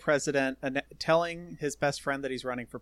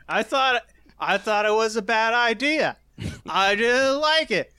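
higher, louder mock old man voice; mocking impression; 8 semitones higher, 8 dB louder than usual. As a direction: ham up a loud whiny old friend. Impersonating the speaker loudly.

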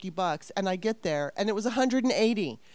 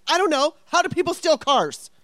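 high, loud exasperated outburst; exasperated retort; 9 semitones higher, 9 dB louder than usual. Retold or imitated. Telling Bob the car was stolen, exasperated. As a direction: throw it back in loud, high-pitched exasperation.

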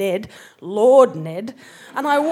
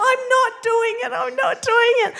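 much higher, whining mock protest; whiny refusal; 13 semitones higher, faster than usual. Exhausted woman in armour refusing to go on, whining high. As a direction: bleat it like an overwhelmed kid.

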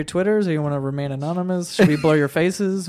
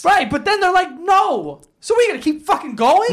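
pitched up, pressing the point; heated high argument; 10 semitones higher than usual. Real, not acted. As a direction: come on, really. Arguing the consent case.

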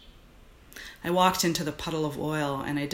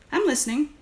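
wife's voice; 11 semitones higher, 3 dB louder than usual. Acted. Wife answers distracted husband.

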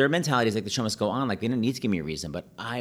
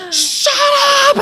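shrieked, much higher and louder; furious yell; 15 dB louder than usual. Retold or imitated. Young [name] shrieks at his grandma, furious yell. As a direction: scream it in outraged panic. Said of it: "I was furious".

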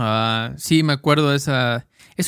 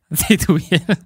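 teasing laugh; 4 semitones higher, slower than usual. Real, not acted. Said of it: "teasing echo".

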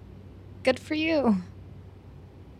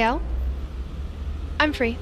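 ordinary talk, nothing marked

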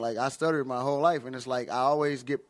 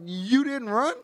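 higher-pitched imitated disbelief; incredulous friend; 8 semitones higher, slower than usual. Jay laughing at the drunk shooter, incredulous mimicry. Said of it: "He's like".

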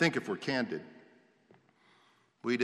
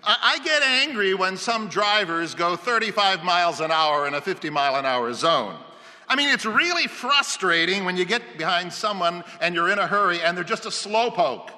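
higher, louder, worked-up complaint; warming to the gripe; 5 semitones higher, 5 dB louder than usual. A narrator's reading. Homeowner warming up to a gripe for the researcher, worked up. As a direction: get going on a pet peeve. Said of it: "warming up to the idea".

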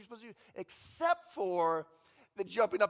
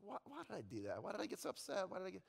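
regretful self-talk; 11 dB quieter, faster than usual. Retold or imitated. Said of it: "you're like".

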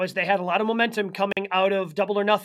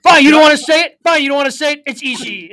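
loud, raised mock exasperation; mock exasperated reveal; 5 semitones higher, 10 dB louder, faster than usual. Wrestler giving up and naming his opponent, mock exasperated. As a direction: blurt it out, fed up. Co-host voices Kingston's reveal.

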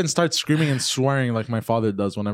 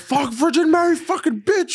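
high, loud screamed curse; screaming curse; 3 dB louder than usual. Retold or imitated. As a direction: scream it like a furious dad. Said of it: "he would scream".

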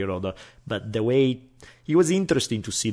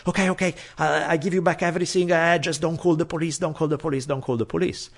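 higher, fast, nervous begging; panicked pleading; 8 semitones higher, faster than usual. Retold or imitated. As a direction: rush it out like a caught thief. Caught burglar begging, panicking.

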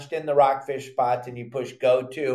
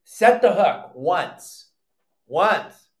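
"Set the hook once" very slow with "Once" stressed; slow it right down and lean on "Once"; emphatic slow; 4 dB louder, much slower than usual. Shown as a demonstration. Fishing teacher correcting jerky anglers, slow and emphatic on "Once".

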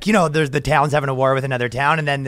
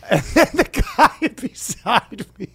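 laughing through the words, higher; real laughter; 5 semitones higher, slower than usual. Real, not acted. Comedian telling his embarrassing plane story, laughing. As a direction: crack up mid-sentence, slowing down.

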